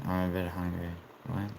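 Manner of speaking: normal talking voice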